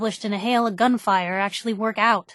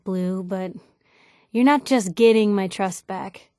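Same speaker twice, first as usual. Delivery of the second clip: ordinary delivery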